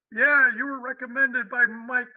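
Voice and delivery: put-on slow, gruff New York accent; heavy New York boss voice. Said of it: "with a real heavy New York accent".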